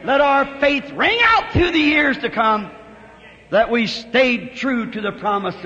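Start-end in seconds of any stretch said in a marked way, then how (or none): none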